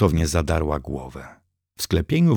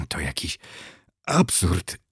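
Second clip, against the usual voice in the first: hoarse; 6 dB quieter than usual. A narrator's reading. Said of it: "wychrypiała".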